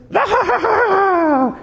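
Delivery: put-on high giggle of a winning kid; squealing mock kid laugh; high gleeful kid laugh. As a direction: imitate a child's triumphant laugh. Son laughing upstairs imitated.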